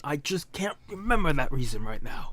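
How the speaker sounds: puzzled aside kept in the affected voice; put-on character voice. Shown as a demonstration. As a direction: stay in character while admitting a lapse. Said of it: "I do that voice for a certain character".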